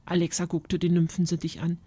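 ordinary delivery, nothing marked

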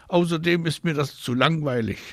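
put-on high-pitched childish grumble; grumpy protest. A narrator's reading. Marie argues with Mama, grumpy and high. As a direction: object like a cross child. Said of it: "Sie guckt ganz grimmig".

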